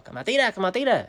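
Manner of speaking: high, insistent retold dare; goading. Brother keeps daring him.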